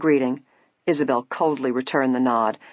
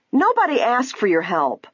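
higher, indignant rebuke; angry outburst; 8 semitones higher than usual. A narrator's reading. Ada rebukes Nancy over the dropped dress, angry outburst. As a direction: tell them off, voice raised in pitch. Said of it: "Ada cried out, her eyes blazing".

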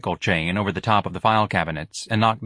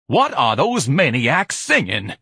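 slow, higher, louder indignant question; exasperated demand; 5 semitones higher, 5 dB louder, slower than usual. Acted. Ignatius to the empty factory about the singers, exasperated. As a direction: complain loudly, drawing the words out. Irritated hero complains about singing.

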